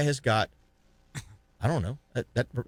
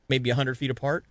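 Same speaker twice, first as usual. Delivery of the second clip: ordinary talk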